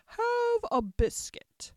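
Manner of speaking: high, slow, drawn-out offer; good-natured queen. A narrator's reading. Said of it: "said good-naturedly".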